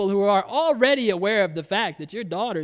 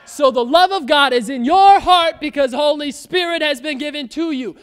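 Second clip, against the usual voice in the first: loud, raised preaching voice; preaching shout; 5 semitones higher, 6 dB louder than usual. Real, not acted. Preacher rousing the congregation, loud and raised. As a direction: proclaim it to the whole room.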